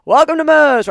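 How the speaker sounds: loud, high hollered welcome; big chorus-style shout across the room; mock shop greeting. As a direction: holler it like staff greeting a customer. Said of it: "Where everybody goes".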